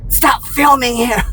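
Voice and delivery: high, slow mock shout. Woman shouting at me to stop filming, high mock shout. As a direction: yell it like the angry woman.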